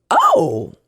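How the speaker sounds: high, light innocent question; a little girl's delighted discovery; daughter's cheerful reply about God, high pitched; bright child voice. Retold or imitated. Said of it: "She's like".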